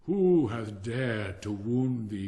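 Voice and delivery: slow, loud, outraged cry; the giant cries out in fury at the child's wounds; angry cry. Acted. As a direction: demand it slowly, full of anger. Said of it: "cried the giant".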